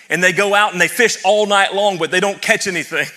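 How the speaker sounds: preaching build. Real, not acted.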